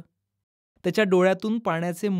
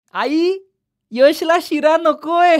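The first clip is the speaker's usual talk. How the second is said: teasing laugh; 8 semitones higher, 5 dB louder, much slower than usual. Acted. Nikita teasing to mother.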